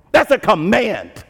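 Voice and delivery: ordinary talk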